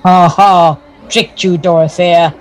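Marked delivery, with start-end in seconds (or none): 0.0-0.8 big wheel's gloating ha ha; mocking laugh. Big wheel laughs at Dorothea after tricking her, gloating. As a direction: laugh at the friend you just tricked. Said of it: "now he is laughing".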